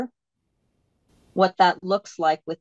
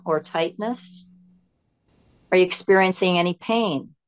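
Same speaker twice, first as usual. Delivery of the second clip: guided meditation. Acted. Teacher leading listeners to let go and go inside, guided meditation. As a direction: guide them inward, phrase by unhurried phrase.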